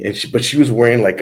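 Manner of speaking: usual speaking voice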